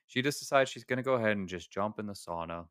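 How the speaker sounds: ordinary talk, nothing marked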